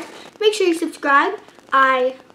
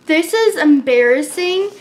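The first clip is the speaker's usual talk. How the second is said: ordinary talk, nothing marked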